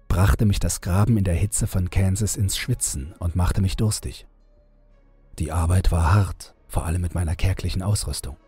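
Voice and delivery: ordinary delivery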